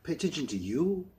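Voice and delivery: high, loud disbelieving echo; incredulous. Acted. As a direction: throw her words back at her. Incredulous retort in argument.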